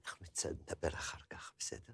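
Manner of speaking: a secretive whisper under her breath. Acted. Whispering a secret plan to her companion, hushed. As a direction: lean in and whisper it low.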